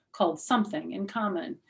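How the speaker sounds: usual speaking voice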